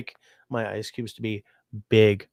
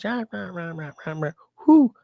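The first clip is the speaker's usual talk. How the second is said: high squawking then a hoot; mock bird squawks; 9 semitones higher than usual. Retelling the raven fight, high squawks and hoot.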